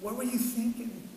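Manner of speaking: soft tender question; gentle questioning. Retold or imitated. Father gently asking his daughter, tender voice. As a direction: ask it gently, not scolding. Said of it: "and I say".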